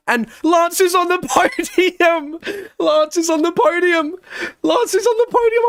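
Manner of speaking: high, loud, overjoyed shouting with a laugh; ecstatic cheering. Real, not acted. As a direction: scream with joy for your driver. Cheering Lance onto the podium.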